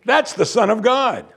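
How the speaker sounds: half-laughing demon insisting; mocking demon. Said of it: "The demon's like".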